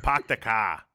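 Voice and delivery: a quick mock Boston "Pak the ka", r dropped; playful Boston accent joke. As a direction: toss off a jokey Boston "Pak the ka". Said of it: "Can you do one?".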